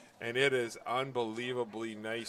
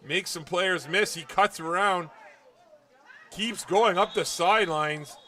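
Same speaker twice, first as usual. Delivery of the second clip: loud, raised, excited football call; live play-by-play; 5 semitones higher, 10 dB louder than usual. Real, not acted. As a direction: call the breakaway run as it unfolds.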